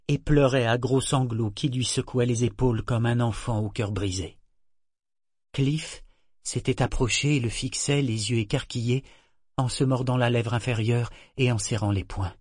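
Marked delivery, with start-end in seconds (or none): none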